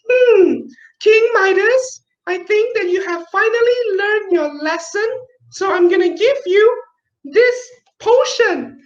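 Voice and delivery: a fairy's high, light voice; magical figure voice. Acted. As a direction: speak up high like a kindly magic being. Story figure speaks to king.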